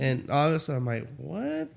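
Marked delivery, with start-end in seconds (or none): 1.2-1.8 high, soft rising "what"; mock bafflement. Reviewer reacting to the comic's twist, mock baffled. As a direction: squeak out a quiet, put-on disbelief.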